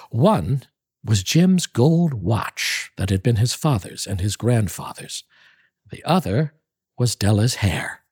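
measured, expressive reading voice; lively storybook narration; story narration. Acted. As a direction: read it like a classic tale by the fire. Reads O. Henry story aloud.

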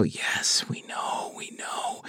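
hushed whisper, trailing off. Pretend. Host whispers wearily about the flood of Renner texts. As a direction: whisper it like a tired aside.

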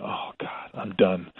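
unvoiced whisper of dread; whispered oh God, sure he's fired. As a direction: breathe it out like you know you're finished. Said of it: "I'm going".

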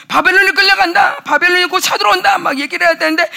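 loud, high proclamation; preaching. Real, not acted. Preacher proclaiming the prophet's warning, loud. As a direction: declare it like a prophet.

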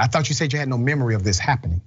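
usual speaking voice

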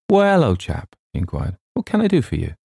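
high-pitched, friendly greeting; genial old chum. A narrator's reading. Old friend asking what he can do, genial.

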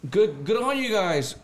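high, slow relieved praise; lightly hammed guide voice; mock guide praise. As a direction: cheer them from the bank.

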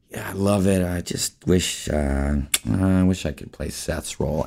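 mock-sheepish admission, trailing reluctantly. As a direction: retell a timid request, hedging with pauses. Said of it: "I sort of sheepishly said".

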